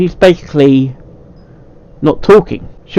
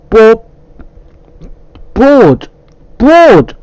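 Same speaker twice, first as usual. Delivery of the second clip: word forced out louder and higher each time; escalating shout at a stuck word; frustrated shouts; 11 semitones higher, 5 dB louder, much slower than usual. Real, not acted. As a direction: blurt it until it comes out right.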